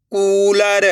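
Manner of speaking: कूलर with a long held oo; drawn-out vowel. Shown as a demonstration. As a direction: stretch the कू in कूलर. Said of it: "तो छोटू की मात्रा का उच्चारण हो जाएगा".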